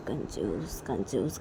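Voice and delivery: low, quiet muttering; grumbled name-calling. Acted. Cousins grumbling about Kajal the miser, muttered. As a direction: mutter the insult under the breath.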